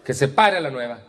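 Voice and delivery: high-pitched mock order; imitating an overbearing meeting leader; unpleasant commanding tone. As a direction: bark it out as a tactless order. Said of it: "su pinche tono de voz".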